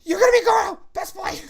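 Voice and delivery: squealing fangirl. Retold or imitated. Imitating a friend squealing about the costume. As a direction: gush like a thrilled fan. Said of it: "You're like".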